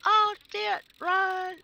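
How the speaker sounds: panicked yell. Retold or imitated. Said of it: "I would have said".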